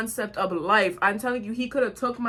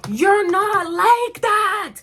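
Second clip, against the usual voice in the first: loud, high, drawn-out disbelief; slow, incredulous yell; incredulous outburst; 10 semitones higher, 8 dB louder, much slower than usual. Retold or imitated. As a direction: call him out, stretching the words high and loud. Mocking car guy's bravado.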